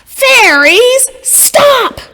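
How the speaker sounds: high, loud, slow cry with ears covered; old woman's loud plea. A narrator's reading. Old woman begs the loud fairies to stop, high and loud. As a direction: beg the noisy fairies to stop. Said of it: "She began to cover her ears".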